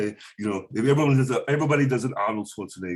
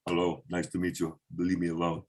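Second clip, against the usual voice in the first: Arnold Schwarzenegger impression; 7 semitones lower, 6 dB quieter than usual. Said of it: "he's like".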